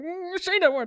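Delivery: swooning. Retold or imitated. Host swooning whenever Natalie says the word, mock swoon. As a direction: hum it like a smitten fan. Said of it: "my heart skips a beat every time".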